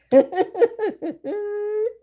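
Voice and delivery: high-pitched laughing fit; long, high burst of real laughter. Real, not acted. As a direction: laugh out high, well above speaking pitch. Laughs at what cards say.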